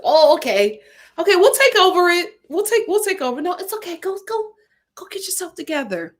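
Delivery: imitating staff gently soothing an upset coworker; hurried reassurance. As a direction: shoo them off kindly, high-pitched. Acting out soothing a crying manager.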